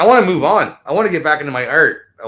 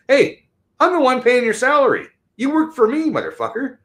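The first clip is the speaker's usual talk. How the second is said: high, louder angry confrontation; telling them off; 12 semitones higher, 4 dB louder than usual. Pretend. Telling off officials whose salary he pays, high and louder. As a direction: shout it at the official you pay. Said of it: "If you tell them".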